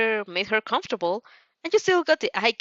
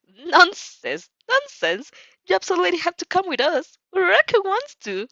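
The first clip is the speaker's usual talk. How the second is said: high, fast, pushy cheer; eager insistence; 10 semitones higher, 3 dB louder than usual. Acted. Mina pushing her friend to join, high and eager. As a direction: brush off the doubt, high and quick.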